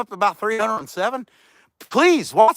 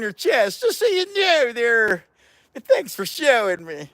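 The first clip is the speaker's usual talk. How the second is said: put-on higher, odd leering old man voice; grumpy old jerk; 7 semitones higher than usual. A vlogger plays an old jerk mocking a woman, higher and odd. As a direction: tease her like a rude old geezer. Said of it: "that's a jerk".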